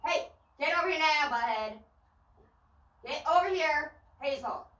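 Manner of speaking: high distant call to the dog; calling from far away. Real, not acted. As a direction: yell to the dog from afar.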